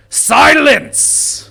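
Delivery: high, loud roaring order; shouted command. Acted. Goblin King silencing the whispering goblins, shouting. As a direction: shut the crowd up at once.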